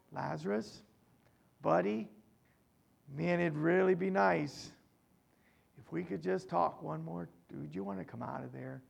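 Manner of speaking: soft, quick, casual pleading, nearly mumbled; mock timid coaxing. Preacher mocks a meek Jesus coaxing Lazarus softly. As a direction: coax him out gently like a buddy. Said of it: "Jesus just didn't go".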